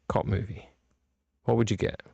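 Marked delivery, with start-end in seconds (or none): 0.1-0.7 "cop movie" said low and soft; dropping low. Shown as a demonstration. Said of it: "Werewolf movie and a cop movie".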